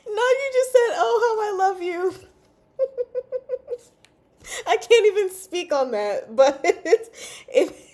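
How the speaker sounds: flustered giggling denial; laughing. Real, not acted. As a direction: choke out the denial between giggles. Denying what she said, laughing.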